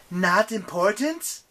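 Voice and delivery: slow, high, loud indignation; outraged goddess. Acted. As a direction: stretch it out in loud offended disbelief. Insulted goddess reacts angrily.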